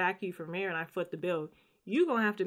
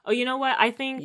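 sudden idea; 7 semitones higher, 6 dB louder than usual. Real, not acted. Sudden realisation mid-chat.

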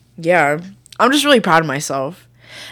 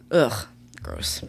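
annoyed ugh; 8 semitones lower, 10 dB quieter than usual. Real, not acted. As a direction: sigh then a low, soft "ugh, gross". Disgusted sigh about men.

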